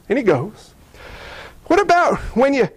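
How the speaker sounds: ordinary talk, nothing marked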